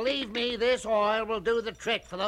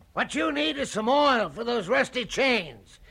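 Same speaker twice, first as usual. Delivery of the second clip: ordinary delivery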